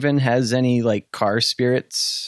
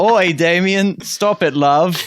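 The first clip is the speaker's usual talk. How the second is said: hammed-up British accent, louder and slow; 7 semitones higher, 7 dB louder than usual. Doing Whitaker in a British accent at a friend's request. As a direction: put on a cheeky British accent. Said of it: "You have to do it in a British accent".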